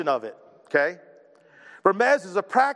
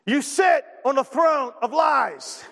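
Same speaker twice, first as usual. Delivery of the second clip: movie line impression; 5 semitones higher, slower than usual. Pastor hams up a movie line for the congregation, slow laughing shout. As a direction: bellow the line like the angry elf, laughing. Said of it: "anytime you mention a movie line".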